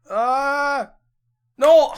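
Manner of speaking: high, loud cry of no; real frustrated scream; anguished shout. Real, not acted. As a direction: yell in real dismay at the game. Getting punished in game.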